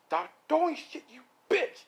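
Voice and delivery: high-pitched exasperated yell; acted furious squawk; angry outburst. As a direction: snap at someone pelting you, voice way up. Angry husband dodging plates.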